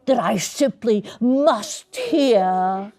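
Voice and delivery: slow words with a chuckle; slow chuckling villain; grinning Grinch. Acted. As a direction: savour it with a sly laugh. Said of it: "grinned the Grinch".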